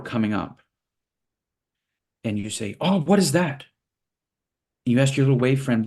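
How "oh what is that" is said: curious surprise. Acted. Wave sees shore approaching.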